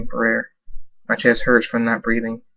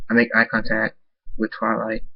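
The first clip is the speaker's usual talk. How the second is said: ordinary delivery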